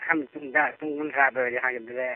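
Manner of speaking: ordinary talk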